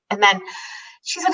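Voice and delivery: ordinary delivery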